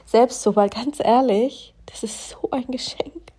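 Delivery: genuinely moved, voice rising then dropping to a whisper; touched, the last words almost whispered. Real, not acted. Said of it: "es berührt mich gerade so".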